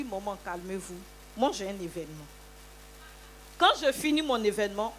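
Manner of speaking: normal talking voice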